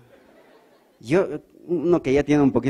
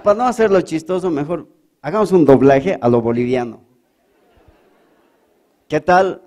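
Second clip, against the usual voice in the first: ordinary delivery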